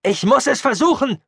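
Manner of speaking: high, desperate yell; shouting. Acted. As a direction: yell it in her face. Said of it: "schrie er ihr ins Gesicht". (ihr ins Gesicht geschrien)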